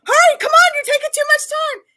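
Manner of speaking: high, loud mom yell out the car window; loud, high mom calling across the school lot; hollering. Retold or imitated. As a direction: call her over, loud and high. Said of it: "hollering at her name".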